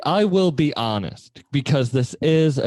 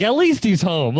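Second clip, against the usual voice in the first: a hint of a relieved mother, higher; relieved mom; 7 semitones higher than usual. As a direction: lightly voice the trusting mom. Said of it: "And she's like".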